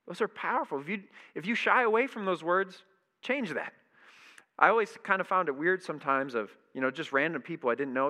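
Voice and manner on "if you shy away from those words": ordinary delivery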